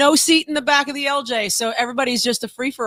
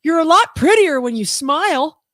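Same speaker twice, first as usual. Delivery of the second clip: imitating a stranger; 6 semitones higher, 4 dB louder, slower than usual. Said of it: "some stranger ... say".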